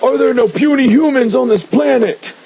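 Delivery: high-pitched mock bellow of a baffled brute; Hulk. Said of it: "the Hulk is like".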